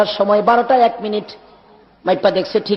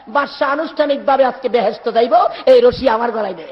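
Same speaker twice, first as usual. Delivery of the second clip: ordinary delivery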